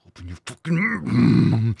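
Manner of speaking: exasperated demand. Real, not acted. Fed-up viewer ordering the anchor to open up, drawn out. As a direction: stretch it out in frustration. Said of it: "I just wanna go, ah".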